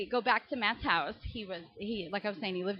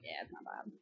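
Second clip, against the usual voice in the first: whispered aside; 14 dB quieter than usual. Retold or imitated. She whispers to herself at the fake name. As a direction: breathe it under your breath. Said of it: "I'm like".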